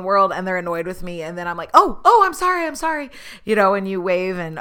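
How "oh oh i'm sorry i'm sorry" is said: flustered apology. Retold or imitated. Apologizing to annoyed driver.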